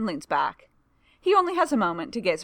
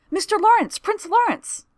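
high, loud starstruck outburst; flustered clerk suddenly recognising the prince; excited exclamation; 8 dB louder than usual. Acted. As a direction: blurt the name as the penny drops. Aide realises who he is.

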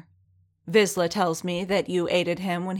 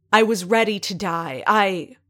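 louder, rising angry shout; angry cry, pushed louder; angry outburst; 3 semitones higher, 6 dB louder than usual. Acted. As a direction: burst out at her in fury. Furious Din shouting at armorer.

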